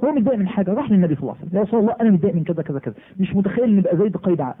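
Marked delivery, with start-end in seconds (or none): 1.6-4.5 hurried, wound-up objection; rushed complaint. Retold or imitated. Jafar rushing his complaint to the Prophet, fast and hurried. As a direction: rattle off the grievance fast.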